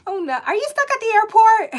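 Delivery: high, loud exclamation; loud dismay. Real, not acted. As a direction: burst out in alarmed disbelief. Reacting to stranded chat member.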